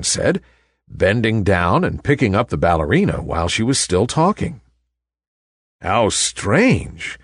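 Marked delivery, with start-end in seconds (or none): none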